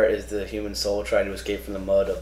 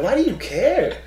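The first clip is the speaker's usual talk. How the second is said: high-pitched incredulous retort; mock outrage; 9 semitones higher than usual. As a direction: squeak out the disbelief. Mocking his complaining coworkers.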